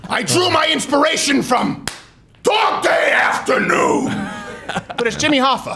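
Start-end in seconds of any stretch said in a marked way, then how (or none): 0.1-4.1 mock Pacino. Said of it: "It's like".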